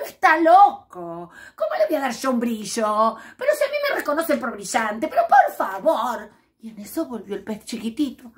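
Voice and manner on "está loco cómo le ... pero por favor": loud, high huffy disbelief; indignant scoff. Acted. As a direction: scoff at the idea, offended. Vain fish scoffs at advice.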